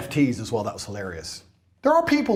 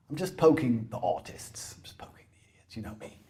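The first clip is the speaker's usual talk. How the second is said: whisper; 7 semitones lower, 9 dB quieter than usual. Real, not acted. Confiding aside about crypto.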